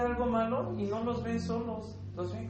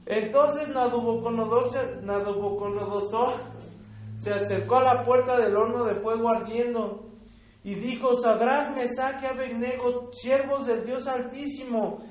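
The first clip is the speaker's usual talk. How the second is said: loud, emphatic Bible reading to the congregation; scripture reading; 6 dB louder than usual. Acted. Reads Nebuchadnezzar's scripture aloud. (lectura bíblica enfática y fuerte)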